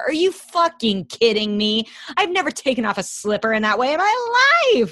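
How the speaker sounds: high, loud, incredulous outburst; outraged disbelief. Real, not acted. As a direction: react in scandalized disbelief, voice rising and loud. Indignant reaction to slipper scene.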